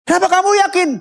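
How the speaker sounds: very high, pressing question; incredulous boss. Retold or imitated. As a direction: challenge the employee's certainty. Acted boss challenging the answer. (atasan bertanya heran dengan nada tinggi)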